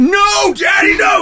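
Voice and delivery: put-on high, loud childlike wail of no; hammed-up little boy crying no; dramatic protest. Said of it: "He gets real dramatic".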